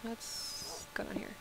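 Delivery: slow hushed whisper; slow sneaky whisper going down. Real, not acted. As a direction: creep in quietly, whispering. Sneaking into cave.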